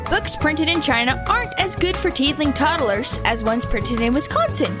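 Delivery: usual speaking voice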